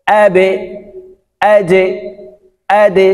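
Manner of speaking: "আবে", "আজে", "আদে" each bounced down to e; downward qalqala. Shown as a demonstration. Teacher recapping the last three, "আবে", "আজে", "আদে", downward qalqala. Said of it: "নিচের দিকে ধাবিত করে".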